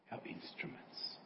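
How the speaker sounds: whispered, soft ending; hushed. Real, not acted. Preacher closing the story, whispered. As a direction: let the words fall to a whisper. Sermon point dropped to hush.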